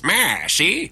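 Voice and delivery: gangster-movie Mid-Atlantic, high and loud; old-school Mid-Atlantic accent. Retold or imitated. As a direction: snap it out like a 1930s newsreel tough guy. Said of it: "the old school Mid-Atlantic was".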